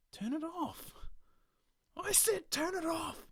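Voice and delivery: very high, loud, slow menacing order; menacing demand. Acted. Stranger ordering the bartender to turn off the hand.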